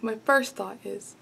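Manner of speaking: usual speaking voice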